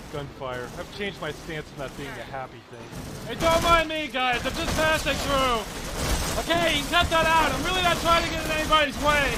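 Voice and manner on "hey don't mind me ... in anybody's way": high, loud frantic shouting; panicked, indignant yelling; yelling under fire. Acted. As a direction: shout over gunfire to the attackers. Yelling to attackers amid gunfire.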